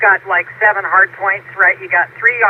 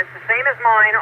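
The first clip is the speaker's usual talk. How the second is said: ordinary talk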